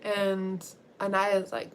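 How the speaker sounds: ordinary talk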